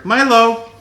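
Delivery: sharp call across the room; calling the dog. Real, not acted. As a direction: call the dog's name firmly.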